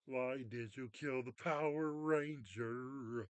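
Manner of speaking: slow, drawn-out mock lament; mock anguished protest. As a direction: wail it slowly as a hammed-up complaint. Acted protest at character's death.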